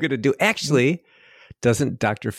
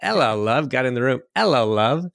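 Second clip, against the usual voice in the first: imitating British greeting; 3 semitones higher than usual. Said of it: "he would just go".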